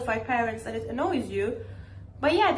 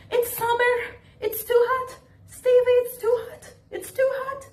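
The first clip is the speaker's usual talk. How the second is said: much higher, whining mock parent refusing a hug; whiny parent excuse; 12 semitones higher, 4 dB louder than usual. Mocking a parent refusing a child's hug, high whiny voice. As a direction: whine the excuse over and over. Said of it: "they be like".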